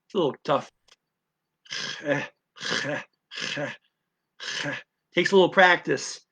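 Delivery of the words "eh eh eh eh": short repeated eh, each with a catch in the throat; throat hitch drill. Shown as a demonstration. Teacher drilling the throaty sound for learners. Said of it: "say the little hitch and then the eh".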